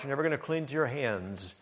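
ordinary delivery

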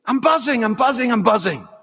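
high, giddy, repeated exclamation; childlike enthusiasm, pitched right up; excited. Retold or imitated. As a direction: imitate an overjoyed fan who can't contain himself. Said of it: "He's so excited".

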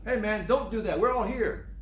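high-pitched calling out to stop him; acted shout of reassurance; urgent call. Prisoner shouting to the jailer not to harm himself, urgent. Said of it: "Somebody shouted at him".

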